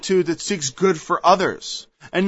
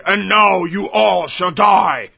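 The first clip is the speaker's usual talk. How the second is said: mock vengeful shout. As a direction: thunder like a vengeful prophet.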